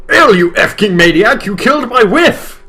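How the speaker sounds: high, loud, crying yell. Acted. Lightstad yelling at L over his dead wife, high and loud. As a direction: scream it with everything. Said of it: "yelled as loud as he could".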